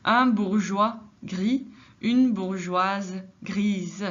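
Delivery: very slow, clear bourgeois gris then bourgeoise grise; over-articulate the z sound in bourgeoise and grise; teacher slowly modelling the feminine forms; slow model. Shown as a demonstration. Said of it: "Par exemple".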